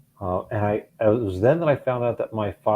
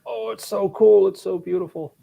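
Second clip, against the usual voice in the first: gushing; 11 semitones higher, 4 dB louder than usual. Retold or imitated. People gushing over the new station wagon, mock admiring. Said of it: "people were probably like".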